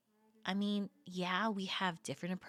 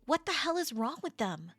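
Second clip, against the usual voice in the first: indignant; 6 semitones higher, 3 dB louder than usual. Acted. Outraged on friend's behalf.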